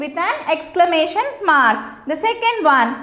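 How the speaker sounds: ordinary talk, nothing marked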